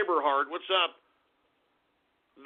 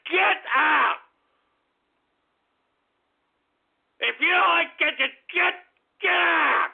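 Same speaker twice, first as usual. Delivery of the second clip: angry shout; 7 semitones higher than usual. Real, not acted. Streamer ordering a viewer out of chat, angry shout. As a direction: throw them out.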